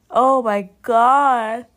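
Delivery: slow words stretched through a yawn. Real, not acted. Mom yawning early in the morning, words stretched by the yawn. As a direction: talk through a big yawn. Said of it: "You see, I'm yawning".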